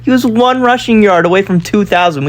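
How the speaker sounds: thrilled at the near-record stat; amazed excitement. Real, not acted. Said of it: "We can't be serious".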